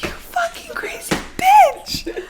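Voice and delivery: slow, high, shocked mock voice; hammed-up high mock insult; high mock outrage. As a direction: drag it out in disbelief, high. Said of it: "I'm like".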